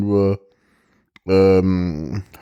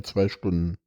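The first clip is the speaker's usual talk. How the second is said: quiet aside; 4 semitones lower, 7 dB quieter than usual. Acted. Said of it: "hab ihn relativ gut betont".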